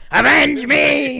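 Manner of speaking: high, loud, slow ghostly cry; ghost's command. Retold or imitated. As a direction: bellow it like a vengeful ghost. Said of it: "the ghost of".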